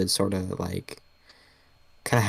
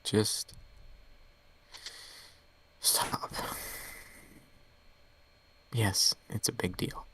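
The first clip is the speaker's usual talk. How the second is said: real hushed whisper, softer; 8 dB quieter, slower than usual. Real, not acted. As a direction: keep it down so nobody hears. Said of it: "Brother's fucking coughing in the other room".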